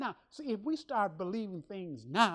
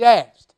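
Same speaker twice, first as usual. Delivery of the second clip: "Asked" punched out very loud; "Asked" thrown out as an emphatic shout; stress on Asked; 21 dB louder than usual. Shown as a demonstration. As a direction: hit the word "Asked" as one hard, loud blow. Stresses scripture's word against begging, moaning.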